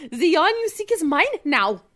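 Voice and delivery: German-accented gloating; German villain. Shown as a demonstration. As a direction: claim the prize as a German mastermind. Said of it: "Just do it like that".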